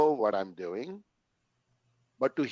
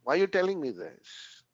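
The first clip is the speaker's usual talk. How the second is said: dismissive retort; 7 semitones higher than usual. Retold or imitated. Retelling his first counseling session to the counselor, a mock dismissive scoff. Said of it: "I said that the first meeting".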